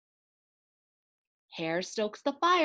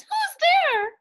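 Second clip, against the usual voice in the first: much higher, louder squeaky cry; startled mouse cry; 9 dB louder than usual. Acted. Mouse calling out at glowing eyes, high startled squeak. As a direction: squeak out in alarm. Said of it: "Mouse cries".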